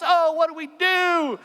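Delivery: high, slow wailing plea; despairing villagers. Acted. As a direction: moan it up to the gods in despair. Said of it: "crying out to their pagan gods".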